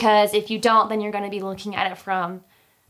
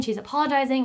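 normal talking voice

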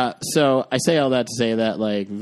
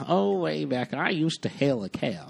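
higher, put-on old-timer reminiscing; mock old-timer; 5 semitones higher than usual. Joking to the hosts about being old, mock old-timer.

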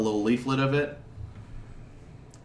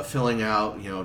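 usual speaking voice

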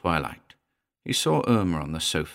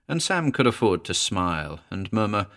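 plain reading voice

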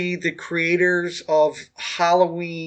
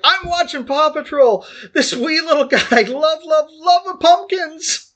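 put-on much higher, childlike excited squeal, slowing at the end; gushing cartoon fan; 9 semitones higher than usual. Groggy on pain meds, gushing to Nick about Paw Patrol, high giddy squeal. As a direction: cheer it like a giddy kid watching cartoons. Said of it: "It's like".

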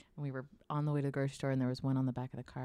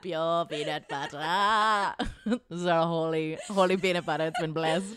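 laughing mock reverence; 5 dB louder than usual. Real, not acted. Mock solemn joke, laughing.

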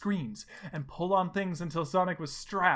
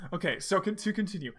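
usual speaking voice

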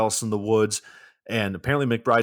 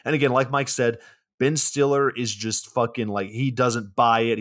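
normal talking voice

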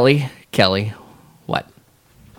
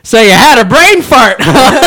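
teasing, higher and louder, laughing; 11 semitones higher, 9 dB louder than usual. Retold or imitated. Supervisor teasing the worker while laughing. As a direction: poke fun while cracking up. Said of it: "walks away laughing".

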